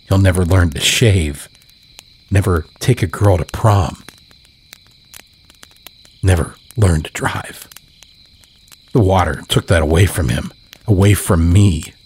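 plain reading voice

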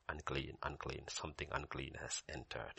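low, very soft announcement; mock warning. Grandpa to Siri with the sandals, hushed mock warning. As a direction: intone it low, under the breath.

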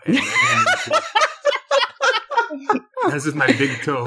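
high giggling laugh; cracking up mid-sentence. Real, not acted. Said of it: "I'm tickling him right now".

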